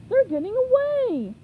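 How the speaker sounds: shout. A narrator's reading. Angry customer yells as the bubble floats off, loud high shout. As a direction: bellow it after the escapees.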